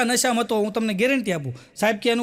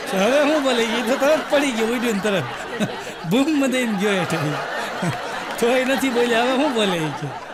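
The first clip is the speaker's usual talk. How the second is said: laughing. Real, not acted. Laughing through the punchline.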